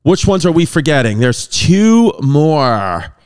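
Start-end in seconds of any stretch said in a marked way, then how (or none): 1.2-3.2 searching memory, dragging each word; drawn-out pondering. Real, not acted. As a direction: stretch out TWO and MORE very long. Straining to recall last two colonies.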